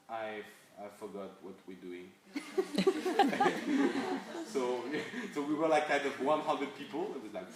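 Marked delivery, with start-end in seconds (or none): 0.1-2.1 sheepish confession. Retold or imitated. Nervous pitcher blanks. 2.2-4.5 a burst of high, loud laughter. Real, not acted. Laughter after anecdote.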